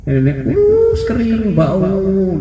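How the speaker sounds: high, drawn-out disgust. Retold or imitated. Preacher describing a foul old woman to listeners, drawn out. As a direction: stretch the words in disgust.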